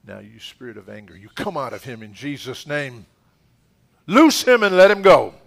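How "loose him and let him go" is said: loud, high ringing command; commanding shout. Real, not acted. Pastor ordering anger out of a man, commanding shout. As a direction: shout it like you're breaking chains.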